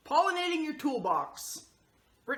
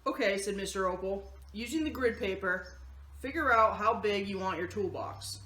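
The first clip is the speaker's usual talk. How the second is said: steady, lower storybook narration; reading to children; 5 semitones lower than usual. Acted. Host reading the picture book to the kids, in calm storybook narration. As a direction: read the story gently to the kids.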